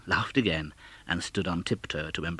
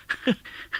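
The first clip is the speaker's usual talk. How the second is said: breathy delighted laugh. Acted. Scrooge laughs.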